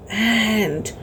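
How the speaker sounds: ordinary delivery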